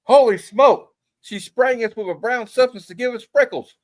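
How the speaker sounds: loud, high comic-book exclamation; mock outrage. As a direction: cry it out like a startled comic character. Acted comic-panel dialogue read aloud.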